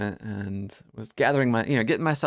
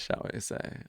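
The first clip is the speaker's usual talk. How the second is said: ordinary talk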